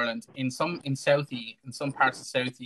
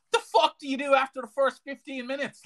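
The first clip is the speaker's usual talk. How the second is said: loud, high, disbelieving retort; higher-pitched mock outrage; incredulous outburst; 11 semitones higher, 4 dB louder than usual. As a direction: blurt it out in disbelief. Acting out outraged reply to the video.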